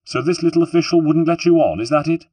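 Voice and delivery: high, loud affronted question; indignant. A narrator's reading. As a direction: demand it in outrage on his behalf. Indignant woman about the official.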